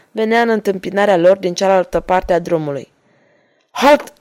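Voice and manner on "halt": sharp loud military halt, pitched up; officer's loud command; commanding shout. Acted. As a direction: bark the order to stop the rider. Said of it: "comandă ofițerul".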